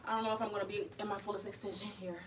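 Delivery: ordinary delivery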